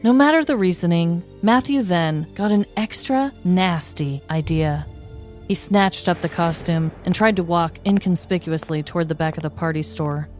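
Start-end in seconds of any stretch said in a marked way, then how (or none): none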